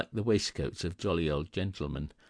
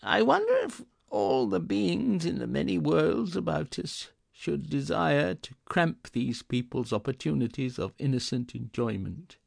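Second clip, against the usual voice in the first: put-on higher, wondering old miser voice; thoughtful Scrooge; 7 semitones higher than usual. A narrator's reading. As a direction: muse it aloud as an old man. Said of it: "said Scrooge, after a moment's thought".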